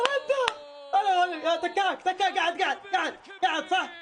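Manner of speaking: high, laughing, triumphant; a fan's high, gleeful crowing; excited celebration. Real, not acted. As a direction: crow over the save. Celebrating the save.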